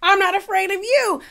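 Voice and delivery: high, loud, repeating defiance; mock defiant Uba. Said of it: "the way Uba turned around".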